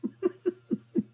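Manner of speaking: high-pitched laugh. Real, not acted. Host amused by his own bad accent, high laugh. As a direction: laugh at your own bit.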